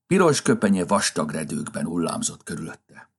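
ordinary delivery

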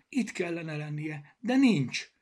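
slow and high, building to a loud, distraught peak; desperate cry. A narrator's reading. Bogi finds the page missing, crying out in despair. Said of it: "kiáltotta Bogi kétségbe esetten".

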